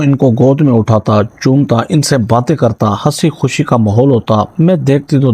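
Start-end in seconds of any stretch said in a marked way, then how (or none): none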